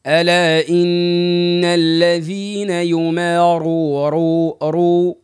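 slow drawn-out رو with the raa clearly sounded; slow teacher demo of ر in يمارون; show the raa. Shown as a demonstration. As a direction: stretch يماروا and make the ر ring out. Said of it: "بين الراء، بين الراء".

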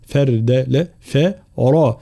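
ordinary delivery

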